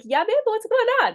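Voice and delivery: delighted mom voice; eager warm reply. Retold or imitated. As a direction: answer your kid like you're happy to hear from her. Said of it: "makes me wanna connect with you".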